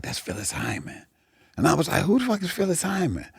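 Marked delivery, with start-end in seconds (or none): 0.0-1.0 very soft, whispered tip-off; hushed aside. Retold or imitated. Band member naming the heckler, hushed confidential aside. 2.0-3.3 high, soft, incredulous voice; puzzled question. Retold or imitated. Comic to band member about heckler, high hushed bafflement. Asks back, not knowing the singer.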